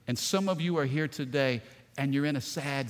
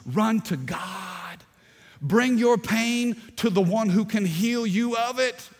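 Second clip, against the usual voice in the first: preaching; 6 semitones higher, 5 dB louder than usual. Acted. Pastor preaching at full force.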